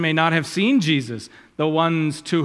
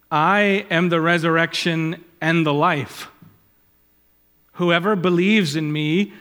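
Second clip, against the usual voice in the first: raised, measured reading of scripture; 3 semitones higher, 4 dB louder than usual. Acted. Preacher quoting Jesus to Martha, solemn reading. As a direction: declaim the words of Jesus.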